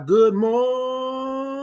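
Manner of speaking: slow, high stretched "Good morning"; singsong stretched "Good morning"; drawn-out good morning. Acted. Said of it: "drawn greeting".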